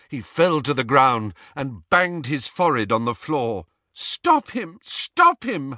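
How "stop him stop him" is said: urgent raised plea; girl begging the boys to stop the elf hurting himself; cried out. Acted. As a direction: call out in alarm for help. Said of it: "Hermione cried".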